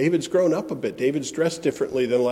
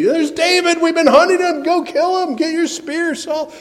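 much higher, louder urgent soldier's voice; armed men urging their king to strike David, high and loud; uneasy soldiers; 13 semitones higher, 5 dB louder than usual. Acted. Soldiers urging Saul to kill David, high, loud and urgent. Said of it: "some of his armed men were a little uneasy".

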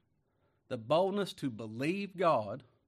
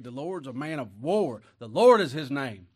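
loud, ringing pulpit declaration; thundering preaching; 11 dB louder than usual. Acted. Preacher declaring the Lord a man of war, booming. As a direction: boom the name out. Reads Exodus with force.

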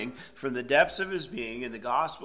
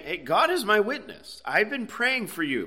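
higher-pitched, insistent quote of Paul; earnest assurance; 7 semitones higher than usual. Retold or imitated. Preacher voices Paul assuring the Romans of his prayers, earnest. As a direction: assure them, pitched up from your usual talk.

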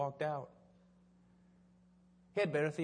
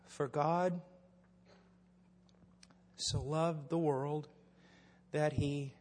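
halting reading; 2 semitones lower, much slower than usual. Retold or imitated. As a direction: read it haltingly, as it sinks in. Acts out man reading verse slowly.

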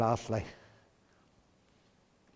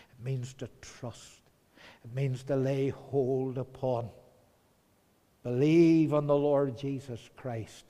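ordinary delivery

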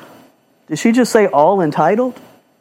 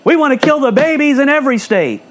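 higher, sneering mock voice of opponents; mocking quote; 7 semitones higher than usual. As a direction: put words in their mouths with scorn. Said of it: "They're saying".

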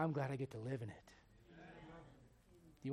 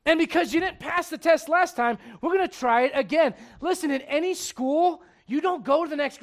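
loud, high preacher's drive; full-force preaching; 12 semitones higher, 17 dB louder than usual. Acted. Pastor pressing the church, loud, high full-force preaching. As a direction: preach it hard to the congregation.

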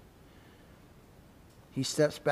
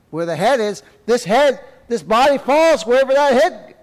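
higher and louder; fervent preaching; 8 semitones higher, 10 dB louder than usual. Real, not acted. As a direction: drive the point home. Preaching builds.

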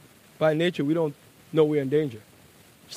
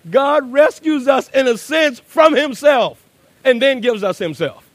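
rising preacher's cry; preaching at full force; 7 semitones higher, 7 dB louder than usual. Acted. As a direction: hammer it home from the pulpit. Preaching climax.

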